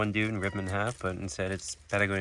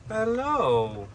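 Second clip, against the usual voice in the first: high-pitched hello; 10 semitones higher than usual. Acted. High greeting to the parrot.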